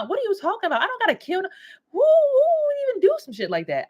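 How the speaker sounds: high-pitched, disbelieving retort; incredulous protest. Retold or imitated. Sister protesting her brother's talk of killing, high-pitched incredulity. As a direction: squeal your disbelief, acting out the argument. Acting out shocked reply to brother.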